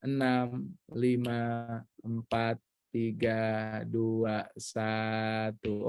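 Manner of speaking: measured countdown that settles into a low monotone; slow countdown. Real, not acted. Counting down ten seconds aloud. (hitung mundur perlahan, suara makin rendah)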